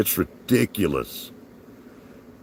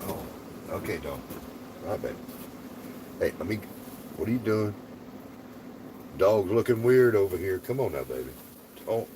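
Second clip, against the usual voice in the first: soft to dog; 3 semitones higher, 6 dB quieter, faster than usual. Real, not acted. Talking softly to the dog.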